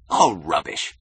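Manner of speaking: loud, irritated exclamation; snapped dismissal. A narrator's reading. Keating dismisses their curious looks, snapping. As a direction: snap it out forcefully. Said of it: "He snapped".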